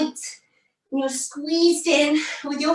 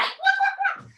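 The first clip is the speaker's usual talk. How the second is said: much higher playful quacking; duck quacks. Pretend. Teacher leading kids as flying ducks, high quacks. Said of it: "ducks and geese go quack".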